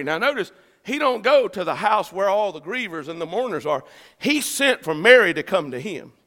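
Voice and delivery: normal talking voice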